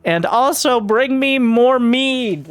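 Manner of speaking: high, drawn-out preacher's proclamation; mock rooftop screaming. Prelate rousing the plebeians from the rooftops, mock screaming. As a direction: proclaim it slowly like a hammed-up prelate. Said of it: "he starts just screaming from the rooftops".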